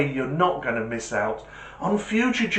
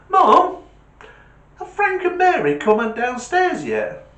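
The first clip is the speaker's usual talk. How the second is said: put-on kid calling mum; 6 dB louder than usual.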